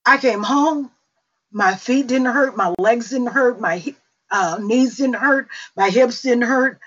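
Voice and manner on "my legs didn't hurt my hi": ordinary talk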